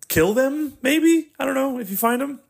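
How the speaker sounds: very high squeaky voice, tentative; mock-tentative suggestion. Reacting to the worst human ever, mock-tentative high voice. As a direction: float it sheepishly way up in falsetto. Half-joking suggestion about terrible people.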